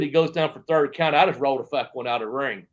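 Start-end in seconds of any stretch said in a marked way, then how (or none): none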